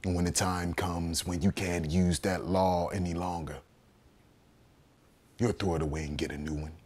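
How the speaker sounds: low, soft, bitter prediction; hushed, low accusation; quiet indictment. Acted. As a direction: drop the voice and state it grimly. Bitter aside on the law.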